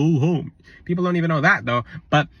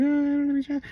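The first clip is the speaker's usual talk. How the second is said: mocking clueless person; 10 semitones higher than usual. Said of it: "People are like".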